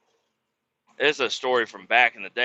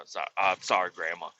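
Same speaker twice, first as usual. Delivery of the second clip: ordinary talk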